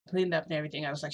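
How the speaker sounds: plain reading voice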